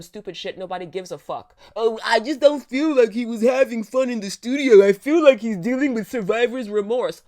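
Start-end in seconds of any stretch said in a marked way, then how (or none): 1.8-7.2 loud whiny mock of the critic; sneering parody, much louder. Mocking a critic's complaint.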